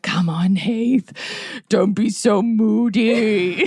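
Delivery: slow, drawn-out coaxing tease; playful teasing coax. Retold or imitated. Parent teasing her moody son, slow playful coax. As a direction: coax a sulky kid, teasing.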